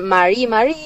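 "mari, mari" with the accent on the final i; stress on final I. Shown as a demonstration. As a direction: lean on the i ending of "mari, mari". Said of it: "hacen una acentuación en la I".

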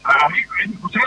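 voz de teléfono entrecortada, como bajo el agua; voz robótica. Real, not acted. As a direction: suena como Terminator por la línea mala. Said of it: "Está como bajo el agua". (voz metálica y distorsionada)

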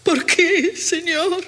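high, tearful lament; llorando. Acted. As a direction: wail a plea to heaven in broken phrases. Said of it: "No llores así". (lamento lloroso y agudo)

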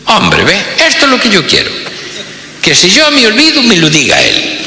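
pompous tycoon delighted; pleased rich boss. Retold or imitated. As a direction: gloat to the salesman.

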